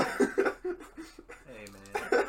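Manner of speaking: high, loud Australian exclamations; Australian accent. Retold or imitated. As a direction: panic like an Aussie wildlife host. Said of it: "Australian, crikey".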